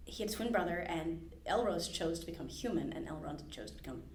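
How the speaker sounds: ordinary talk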